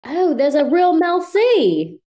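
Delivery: high, loud, slow British surprise; hammed-up British amazement; fake British accent. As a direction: react as an astonished mock Brit. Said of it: "with the British accent".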